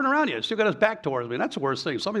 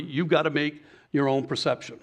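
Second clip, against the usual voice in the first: ordinary delivery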